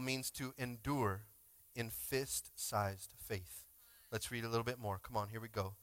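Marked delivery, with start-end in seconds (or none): none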